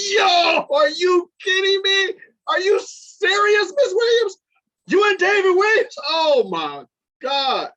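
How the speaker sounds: veel hoger en luider, ongelovig schreeuwend; shocked disbelief. Retold or imitated. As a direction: shout like you can't believe it. Stunned by the teacher's news.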